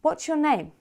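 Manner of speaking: "What's your" held high, falling on "name"; stress at the beginning. Shown as a demonstration. Teacher models an open question, high start on "What's". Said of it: "the stress is at the beginning".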